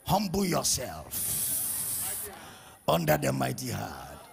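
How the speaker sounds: halting, drawn-out show of piety; mock pompous prayer. People performing for God in prayer, mock pompous prayer. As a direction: pray to impress, with long pauses between phrases. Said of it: "they are trying to impress God".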